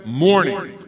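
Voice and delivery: usual speaking voice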